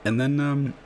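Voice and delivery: a brief, half-unintended American twang on "And then, um"; slipped American accent. Real, not acted. Chess vlogger slipping into a silly American accent. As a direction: drift into an American accent for a moment. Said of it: "almost talked like an, a silly American".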